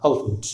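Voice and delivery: "अल्फेंट" as uhl-funt, middle E dropped; rounded two-syllable elephant. Shown as a demonstration. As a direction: roll the tongue round through "अल्फेंट". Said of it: "गोल घूम के निकल जाएगी".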